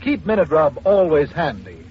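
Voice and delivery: usual speaking voice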